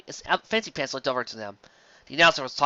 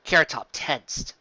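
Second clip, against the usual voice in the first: ordinary delivery, nothing marked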